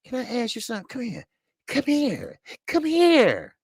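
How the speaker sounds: imitating a scared man calling someone over; mock-timid, climbing pitch. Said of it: "Lomachenko was so goddamn scared".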